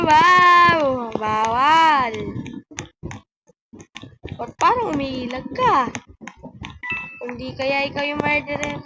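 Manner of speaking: ordinary delivery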